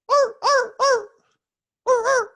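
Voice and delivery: squeaky little arr arr barks; dog barking. Retold or imitated. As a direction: bark like a small stranded dog. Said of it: "a dog looking up at me going".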